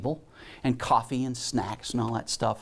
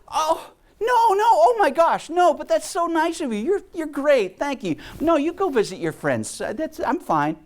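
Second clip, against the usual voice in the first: much higher, louder, flustered protest; embarrassed polite refusal, fumbling; high, flustered mock self; 4 dB louder than usual. Flustered reply to the boy.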